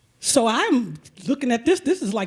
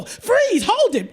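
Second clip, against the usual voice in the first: higher, louder barked "Freeze"; sudden command; 11 semitones higher, 5 dB louder, slower than usual. Retold or imitated. Pedicurist halting her shoes, loud command. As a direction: stop her dead with a sharp order.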